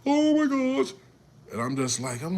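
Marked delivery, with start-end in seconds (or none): 0.0-0.9 high shrill loud wailing; mock the frozen panicking mother, loud; imitating hysterical mom. Mother freaking out beside the crash instead of helping her kids, high wail. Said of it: "That's all she kept saying".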